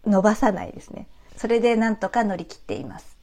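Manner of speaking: ordinary talk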